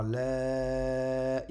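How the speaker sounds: LA held out long and level; prolonged vowel. Shown as a demonstration. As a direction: stretch the vowel of LA.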